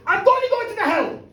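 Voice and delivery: put-on the boy's resolve boils over into a shout; defiant declaration. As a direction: blurt it out like a kid who's decided.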